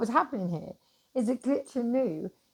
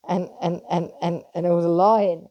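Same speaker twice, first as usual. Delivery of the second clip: much louder on the lying; rising rant; 9 dB louder than usual. Real, not acted. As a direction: let it burst out at the end. Building rant about the world.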